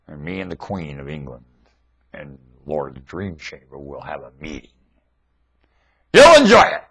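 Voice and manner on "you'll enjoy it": excited promise. Real, not acted. Exclaims promise loudly.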